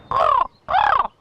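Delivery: two loud, high crow caws; crow's reply caw. Retold or imitated. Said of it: "And he said to me".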